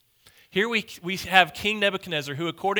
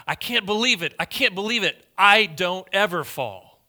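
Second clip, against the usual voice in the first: raised, higher-pitched cry of disbelief; 4 semitones higher, 6 dB louder than usual. Retold or imitated. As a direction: act out the fallen acrobat's indignant outcry. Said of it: "and he cried".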